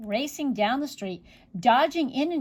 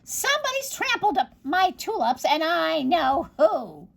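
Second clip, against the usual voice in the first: high, indignant witch voice slowing at the end; angry witch; 6 semitones higher than usual. Acted. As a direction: witch vowing to catch the culprit. Angry witch finds trampled tulips.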